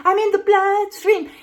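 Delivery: high, loud little germ voice; hammed-up parasite cheer; gleeful candida. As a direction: crow it like an escaped microbe. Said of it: "they escape out into the bloodstream".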